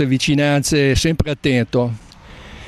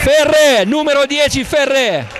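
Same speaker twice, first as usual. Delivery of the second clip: excited shouting; 11 semitones higher than usual. Real, not acted. Naming the goal scorer.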